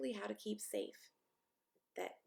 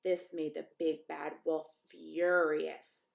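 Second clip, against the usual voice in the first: ordinary delivery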